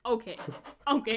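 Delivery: loud stopping okay; firm loud okay. Real, not acted. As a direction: call a halt, loudly. Cutting off gloomy turn.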